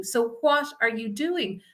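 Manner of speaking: ordinary talk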